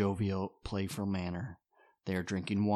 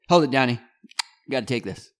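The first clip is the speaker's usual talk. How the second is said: brusque; 4 semitones higher, 11 dB louder, faster than usual. Acted. Rich cutting Don off.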